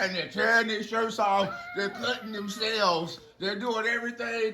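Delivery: high-pitched worked-up telling; frenzied narration. Acted. Storyteller describing prophets going crazy, high and frantic. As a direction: tell it in the crowd's high frenzy.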